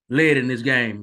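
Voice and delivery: led punched and falling; stress on led. Real, not acted. Vlogger stresses Alabama never led in the game. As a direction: land hard on led. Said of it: "I'm emphasizing that because".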